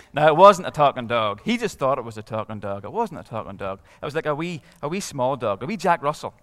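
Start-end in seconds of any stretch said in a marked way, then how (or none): none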